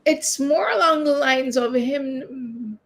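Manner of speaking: ordinary delivery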